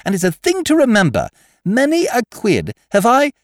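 put-on high, chattering young toff voice. Wooster rambling tips to schoolgirls, chatty young toff. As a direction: prattle on cheerfully to the girls.

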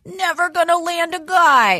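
high, hammed-up lament; mock despair. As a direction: wail it lightly as a comic complaint. Acts out her own stage persona.

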